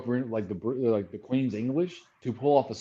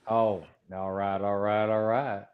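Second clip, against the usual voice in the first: slow, lower Texan drawl; Matthew McConaughey; 3 semitones lower, slower than usual. Acted. Answering who the favorite Texan is, slow Texan drawl. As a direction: draw out each word like a laid-back Texan. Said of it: "Matthew McConaughey".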